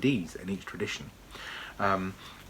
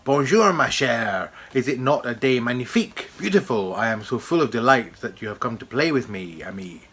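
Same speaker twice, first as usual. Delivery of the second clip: put-on bright, loud French accent with a lift on bonjour; 3 semitones higher, 6 dB louder than usual. Reading the dandelion's speech aloud from the book in a French accent. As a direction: play the French dandelion greeting a friend in a French accent. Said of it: "This dandelion is French".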